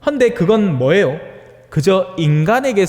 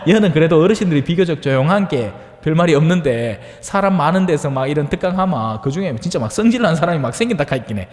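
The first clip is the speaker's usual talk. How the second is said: usual speaking voice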